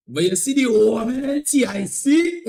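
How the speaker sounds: high, loud hype to the fellas; hyped-up exclamation. Real, not acted. Hyping the event to the fellas.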